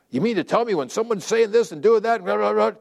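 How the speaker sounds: high-pitched mock complaint; indignant objection. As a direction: play an incredulous objector, pitched well up and rattling on. Voices an indignant objector, trailing into blah.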